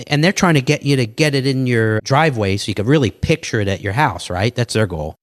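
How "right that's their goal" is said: normal talking voice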